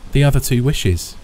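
plain reading voice

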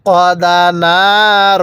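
slow, drawn-out tajweed chanting; teacher reciting slowly for students to copy; Quran recitation. Shown as a demonstration. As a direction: recite each syllable long and even. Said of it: "কিভাবে পড়ব?".

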